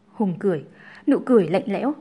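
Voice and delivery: ordinary delivery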